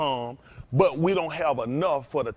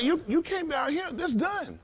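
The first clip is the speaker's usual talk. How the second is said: mock outraged voice pitched far higher; 12 semitones higher, 4 dB quieter than usual. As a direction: play the scandalized neighbor shooing him away.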